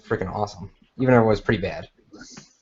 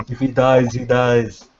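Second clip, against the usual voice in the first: cold, flat Russian boxer quote; Drago impression; slower than usual. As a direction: shrug it off like a ring villain.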